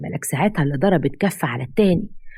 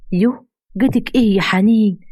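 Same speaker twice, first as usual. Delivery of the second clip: a loud, higher yoh of dismay; scolding surprise; 5 semitones higher, 4 dB louder than usual. Acted. Mother-in-law chides Haneen, loud and higher. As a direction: snap at her in shock.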